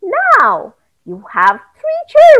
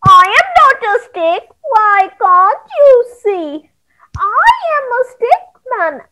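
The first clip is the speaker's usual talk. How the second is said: distressed stick man; 7 semitones higher, 3 dB louder than usual. Acted. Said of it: "the stick man ran and said".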